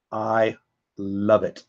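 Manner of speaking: slow, low and soft, each word drawn out; moved, savouring. Real, not acted. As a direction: let it out very slowly, softly, deeply touched. Moved by military family reunion video.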